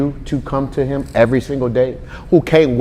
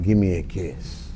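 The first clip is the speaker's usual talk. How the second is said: soft mushy dad; 6 semitones lower, 10 dB quieter, slower than usual. Retold or imitated. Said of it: "'Cause I'll walk up to him".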